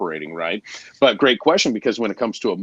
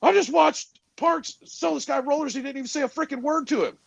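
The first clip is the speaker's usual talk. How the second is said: amazed trainee. Retold or imitated. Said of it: "He's like".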